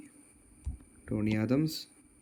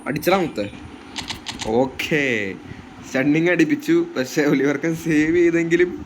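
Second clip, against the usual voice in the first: excited; 2 semitones higher, 3 dB louder than usual. Real, not acted. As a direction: cheer the goal to viewers. Second goal celebration. (ആവേശത്തോടെ goal ആഘോഷം)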